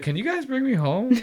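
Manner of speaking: high-pitched imitation of a scared date; mock scared. Host voicing the date asking the crew, mock frightened. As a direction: plead in a put-on high voice. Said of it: "like, all scared and shit".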